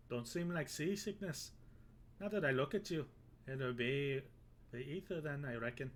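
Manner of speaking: a hammed-up, horrible Irish brogue; bad Irish accent. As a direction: play the Irish sailor, badly on purpose. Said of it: "I've made him Irish".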